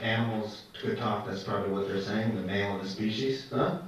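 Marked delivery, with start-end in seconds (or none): none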